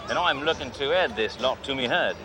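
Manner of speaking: cocky Australian car dealer bragging to a salesman; fake Australian accent. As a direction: play the brash outback tycoon Crocodile Bruce. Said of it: "Name's Crocodile Bruce".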